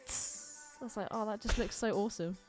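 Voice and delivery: ordinary talk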